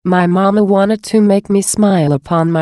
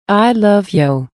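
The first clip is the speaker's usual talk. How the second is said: slower, lingering repeat; drawn-out echo; 2 semitones higher, slower than usual. A narrator's reading. The fish darting, answering the son, slow tender echo. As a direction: let the second one linger tenderly.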